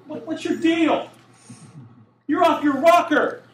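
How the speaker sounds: high-pitched, incredulous outburst; shouting at God. Acted. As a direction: challenge them, voice shooting up. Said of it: "It's almost like they're shouting".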